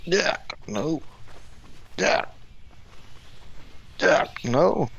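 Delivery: frantic duck no; panicked cries. Real, not acted. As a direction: yelp at the screen in alarm. Panicked shouts at the game.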